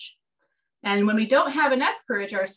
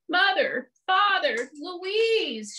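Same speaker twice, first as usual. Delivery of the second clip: crying out; 8 semitones higher, much slower than usual. Acted. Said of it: "she cried".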